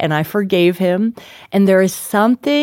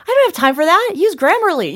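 dismissive brush-off; 10 semitones higher, faster than usual. Retold or imitated. Author mocking her own reply to husband, high and dismissive. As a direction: toss it off, can't be bothered.

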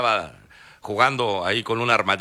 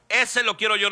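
eager repeat; 10 semitones higher than usual. Retold or imitated. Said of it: "Y luego".

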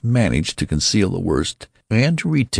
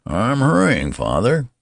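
put-on much higher, meek old man's reply; old man son; 7 semitones higher than usual. A narrator's reading. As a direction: hurry along like an obedient odd child. Old son answering his father.

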